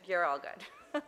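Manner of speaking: ordinary talk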